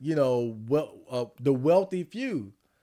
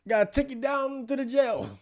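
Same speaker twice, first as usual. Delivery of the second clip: bad British cop accent, pitched much higher; terrible British accent; 10 semitones higher than usual. Streamer mocking UK police in a bad British accent. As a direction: ham up a British copper hauling someone off. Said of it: "I got a terrible British accent".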